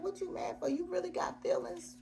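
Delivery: high, mocking disbelief at his jealousy; high, sassy taunt; mocking sass. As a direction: scoff at him in a high teasing voice. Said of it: "I was like".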